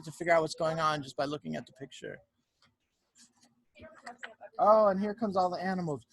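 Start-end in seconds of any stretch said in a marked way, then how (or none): none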